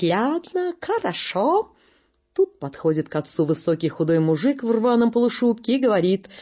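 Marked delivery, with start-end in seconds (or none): 0.0-1.7 laughing German. Acted. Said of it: "ледовик смеётся и говорит".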